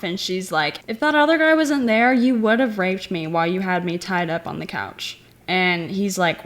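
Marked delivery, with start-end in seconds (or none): none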